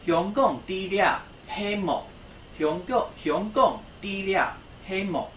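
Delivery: slow repeated demo; slow model sentence. Shown as a demonstration. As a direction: lead the class through it syllable by syllable. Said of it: "at the end of the sentence".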